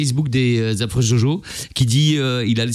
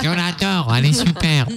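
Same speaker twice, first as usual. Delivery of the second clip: hide your own voice a little; a hint of a disguised caller. Shown as a demonstration. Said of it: "faites un effort, au moins, de masquer la voix".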